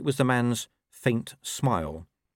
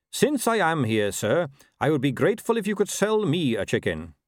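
lightly voiced formal horseman, a bit raised; courteous rider; 6 semitones higher, 3 dB louder than usual.

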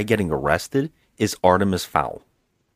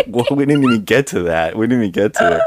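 louder, laughing protest; 3 semitones higher, 4 dB louder, faster than usual. Real, not acted. Host telling co-host they hadn't reached that part, cracking up. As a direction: protest through a fit of laughter. Both hosts in hysterics.

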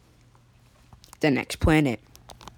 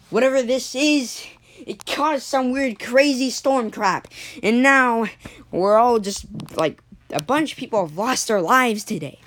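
angry crowd; 5 semitones higher than usual. Retold or imitated. Angry rioting crowd yells.

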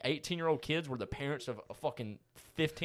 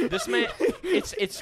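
high, slow laughing words; laughing too hard to talk; 12 dB louder, slower than usual. Real, not acted. Bursting into laughter.

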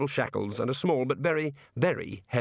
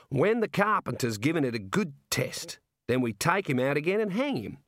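jovial Aussie warder; 5 semitones higher than usual. Acted. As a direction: play a chummy outback prison guard. Warder delivers the punchline.

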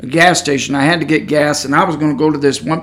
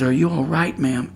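calm, quiet; 2 semitones lower, 8 dB quieter than usual. Retold or imitated. Checking again on the shaken woman, calm and quiet. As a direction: ask very gently, voice dropping. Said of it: "in a very calm, quiet manner".

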